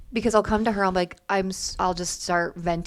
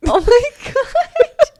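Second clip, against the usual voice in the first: high, loud, slow laughing cry; real laughing gasp; laughing shock; 11 dB louder, much slower than usual. Real, not acted. Reacting to spit flecks.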